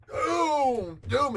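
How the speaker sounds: drawn-out, loud punch noises; imitated punches. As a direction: land two long, heavy booms.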